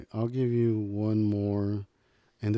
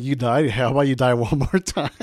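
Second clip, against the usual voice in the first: louder, taunting villain jeer; gloating taunt; mocking villain; 3 semitones higher, 7 dB louder than usual. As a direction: sneer at him like a gloating bad guy.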